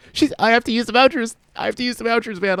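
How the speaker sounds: high, panicked; panicky insistence. Retold or imitated. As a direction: insist in a flustered fluster. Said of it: "I'm like".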